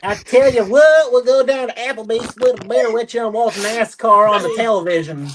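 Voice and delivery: country good ol' boy, played for laughs; good country accent. Shown as a demonstration. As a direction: show off a thick country accent. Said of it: "You want the good country accent?".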